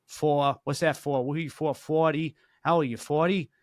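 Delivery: light Boston accent on the O's, "four" and "Forty" said as "fawh" and "fawty". Shown as a demonstration. Demoing the Boston O for his co-host in a light Boston accent. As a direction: lean into the Boston O and drop the R, but keep it light. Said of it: "the O's are kinda".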